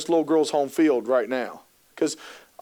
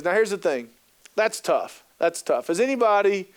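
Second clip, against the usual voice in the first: normal talking voice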